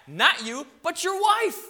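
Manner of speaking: rising to a loud peak on wife; emphatic rise. Real, not acted. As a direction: land hard on wife. Warning the men.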